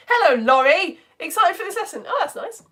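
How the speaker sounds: usual speaking voice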